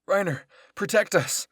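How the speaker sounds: high, very loud cry for protection; yell. A narrator's reading. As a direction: shout urgently to a comrade for cover. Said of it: "Berthold yelled".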